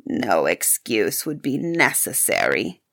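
very low beast voice; low, ominous creature voice; beast's answer. A narrator's reading. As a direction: answer deep and grave, as the beast. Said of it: "answered the beast".

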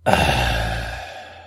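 long, low breathy sigh; weary sigh. Acted. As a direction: let out a long, sinking sigh. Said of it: "Sevens just sighs".

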